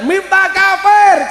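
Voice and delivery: slow, high shouted insult, mimicking the haters; mocking shout. Preacher imitating critics who call him an infidel, mock shout. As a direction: bellow it out slowly like an angry online mob. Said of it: "Di Indonesia saya dihujat".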